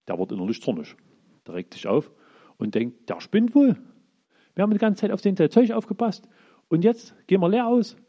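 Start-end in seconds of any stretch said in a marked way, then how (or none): 3.1-8.0 loud, high, fuming complaint; indignant outburst. Acted. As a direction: spit it out like a man cheated of his pay. David fumes at being shortchanged. (empört und laut, deutlich höher)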